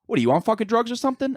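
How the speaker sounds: high-pitched, fast mock of the Cleveland girl with a thick Philly accent; mocking her high thick Philly voice. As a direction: throw the question back in a shrill high mimic. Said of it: "She goes".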